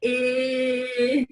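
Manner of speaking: Eh drawn out on a level pitch; isolated vowel sound. Shown as a demonstration. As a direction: model a long Eh with a scrunched nose. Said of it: "scrunch up your nose like me".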